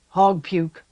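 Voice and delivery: rough, contemptuous voice; gruff Castellan. A narrator's reading. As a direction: spit it out in scorn. Castellan's scorn.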